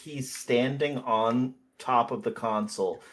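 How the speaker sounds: normal talking voice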